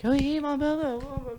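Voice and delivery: high, falsely chummy hello; fake friendly greeter. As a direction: parody a two-faced buddy saying hi. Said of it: "they're like".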